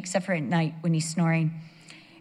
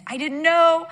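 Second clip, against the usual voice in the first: high, loud defensive retort; protesting innocence; 8 semitones higher, 6 dB louder than usual. Retold or imitated. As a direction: plead innocence loudly as the accused king. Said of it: "Abimelech then declares his righteousness".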